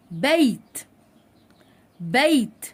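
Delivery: "بيت" with a drawn-out, distinct y glide; audible ya sound. Shown as a demonstration. Teacher checking children hear the ya, carefully enunciated. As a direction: let the y ring out inside "بيت". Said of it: "سمعت صوت الياء ولا ما سمعتش؟".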